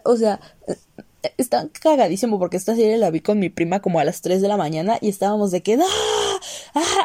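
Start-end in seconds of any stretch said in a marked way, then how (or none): 5.8-7.0 high, laughing mock scream; high laughing shriek; retold scream. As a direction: shriek it while laughing, like a late-night binge. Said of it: "Así gritando".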